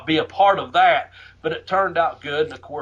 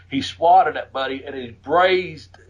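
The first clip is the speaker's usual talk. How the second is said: ordinary talk, nothing marked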